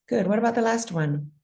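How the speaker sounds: ordinary talk, nothing marked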